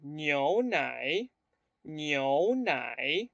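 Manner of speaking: "niu nai" low, tone on U then A; 示范牛奶的声调; Mandarin tones. Shown as a demonstration. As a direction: model "niu nai" slowly. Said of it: "el tono va en la U".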